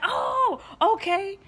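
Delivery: light up as it all clicks; sudden realization. Retold or imitated. Retelling how the scripture made sense to her, high and delighted. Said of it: "I was like".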